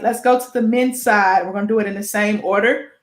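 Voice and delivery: ordinary delivery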